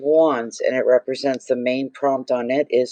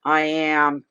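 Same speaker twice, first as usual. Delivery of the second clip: ordinary talk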